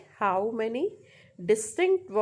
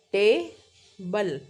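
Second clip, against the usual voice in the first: Table very slowly as टे, pause, बल; counting two syllables; 3 dB louder, much slower than usual. Shown as a demonstration. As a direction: break table into two firm beats. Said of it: "दो बार count होता है".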